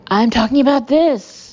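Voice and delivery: loud angry outburst; temper snapping. Acted. Boss brandishing a muffin at colleague, snapping. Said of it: "something inside me snaps".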